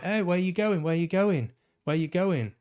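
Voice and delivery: imitating a character shouting after someone, pitched high; mock calling after. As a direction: call out after him as he walks off. Mocking film character calling after.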